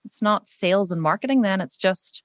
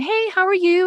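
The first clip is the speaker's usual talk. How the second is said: chirpy cashier greeting; 11 semitones higher, 3 dB louder than usual. Retold or imitated. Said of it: "She went".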